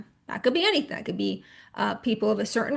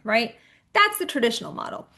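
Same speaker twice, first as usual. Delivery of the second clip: ordinary delivery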